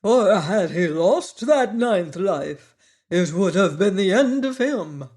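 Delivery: proud Wogglebug showing off his coat; pompous Wogglebug. A narrator's reading. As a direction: speak like a self-important bug. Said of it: "Wogglebug telling his tale".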